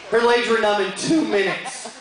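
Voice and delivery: ordinary talk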